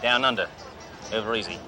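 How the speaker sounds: cocky Australian car dealer bragging to a salesman; fake Australian accent. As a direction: play the brash outback tycoon Crocodile Bruce. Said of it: "Name's Crocodile Bruce".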